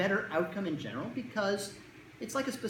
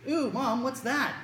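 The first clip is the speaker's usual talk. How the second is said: lightly acted child, higher-pitched question; a kid eyeing a new dish, higher; kid at dinner; 8 semitones higher, 4 dB louder, slower than usual. Child to mom about the dinner, suspicious kid.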